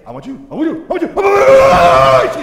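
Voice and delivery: put-on screaming toddler, stretched out at the end; imitated toddler scream. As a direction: scream like a two-year-old demanding juice. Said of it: "She's screaming top of her lungs".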